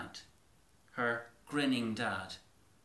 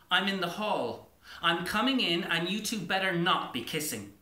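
called out louder through the door; a girl calls out to her parents behind the door, louder; calling out; 5 semitones higher, 5 dB louder than usual. Acted. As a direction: shout it through the closed door, half joking.

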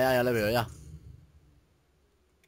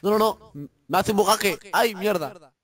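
frustrated yell; 8 semitones higher, 7 dB louder than usual. Real, not acted. Game glitch frustrates player.

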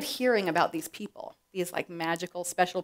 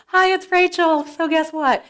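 mock cheery phone call; 7 semitones higher, 6 dB louder than usual. Imagined phone call to siblings.

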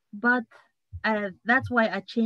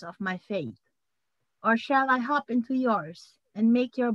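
measured poem reading with pauses between phrases; poem recitation; 3 semitones higher, 3 dB louder than usual. Acted. Poet reading a poem to a best friend on a talk show, measured recitation. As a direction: recite it line by line, lifting the openings and letting endings fall. Reads own poem aloud.

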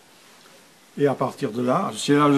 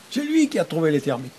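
higher, put-on voice of a thrilled learner; delighted exclamation; 4 semitones higher, faster than usual. Teacher imitating learners very happy to understand a sentence, delighted exclamation. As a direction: exclaim it on a high held pitch, then drop.

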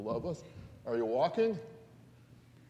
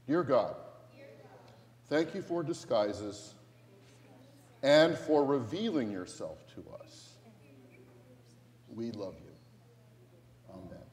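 repeat-after-me prayer. Acted. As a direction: lead the children in prayer, line by line. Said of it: "repeat after me prayer".

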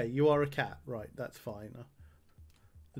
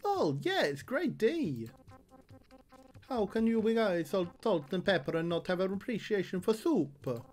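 much higher voiced character; Salt's voice; 5 semitones higher than usual. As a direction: squeak it out high as the salt shaker. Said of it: "what was Salt's voice again".

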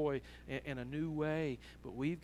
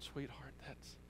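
hushed, halting, as an overcome father; soft, choked-up retelling with gaps between words; moved to tears; 13 dB quieter than usual. Retold or imitated. Father answering his crying daughter, moved and hushed. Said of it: "and he was even crying".

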